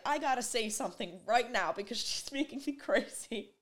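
lightly voiced dad, teasing his daughter; dad's voice. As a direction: voice dad, playful and exasperated. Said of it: "was like".

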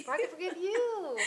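high laughing parody of the line; laughing mock echo. Friend echoes the quote back, laughing and mocking. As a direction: giggle through a mocking echo.

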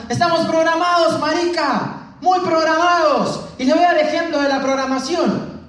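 high, loud shouting Colombian preacher voice; mock shouting speaker. Retelling the Colombian speaker yelling at the crowd, high shouted voice. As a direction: shout it like a fired-up motivational speaker. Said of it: "él gritando".

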